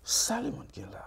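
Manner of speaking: unvoiced thoughtful whisper. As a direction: breathe it as a secret. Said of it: "réfléchi".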